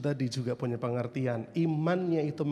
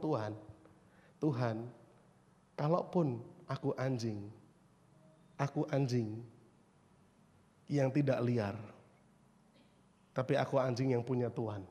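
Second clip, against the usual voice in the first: low, soft, halting plea; acted lowly submission, hushed; humble plea; 4 semitones lower, 6 dB quieter than usual. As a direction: plead humbly with pauses between phrases. Imagines woman humbly pleading to Jesus.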